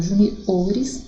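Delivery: juniores with the stress on the O; stress moved to O. Shown as a demonstration. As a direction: lean on "o" in juniores, not "ju". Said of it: "sílaba tônica o".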